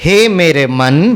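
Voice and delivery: slow, low, lovingly drawn-out words; प्यार से. Shown as a demonstration. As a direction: lead a tender, unhurried call to the mind. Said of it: "सभी प्यार से बोलिए". (प्यार से, धीरे-धीरे)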